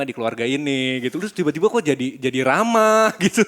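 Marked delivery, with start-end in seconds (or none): 2.4-3.4 slow, higher words through a laugh. Real, not acted. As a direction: laugh out the punchline. Laughing at the turnaround.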